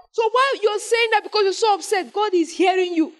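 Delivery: high, fervent preaching; preaching at full force. Acted. Preacher pressing the point on the congregation, fervent. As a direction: drive it home, pitch climbing.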